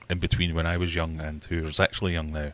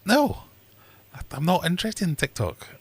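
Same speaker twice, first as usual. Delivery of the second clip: high-pitched refusal; 10 semitones higher than usual. Retold or imitated. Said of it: "I'm like".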